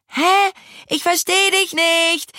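very high, shouted reply; calling back. A narrator's reading. Fee cannot hear Maja while pedalling, calling back. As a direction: call back as if unable to hear. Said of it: "rief Fee".

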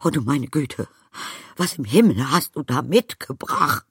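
high, loud, aghast; scandalised, high-pitched alarm; gasping horror. A narrator's reading. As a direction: cry out in shocked dismay. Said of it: "keuchte sie".

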